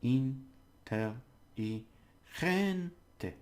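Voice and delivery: inteligente very slowly in separate beats, "gen" pushed up; syllable by syllable. Shown as a demonstration. Teacher trying it with the next word, syllable by syllable. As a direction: pause after each syllable of inteligente, lifting "gen". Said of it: "you take each syllable".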